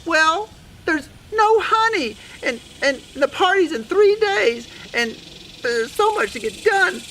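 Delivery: high, whiny mouse lament; distressed Sue Mouse. Acted. Sue Mouse complains in the story.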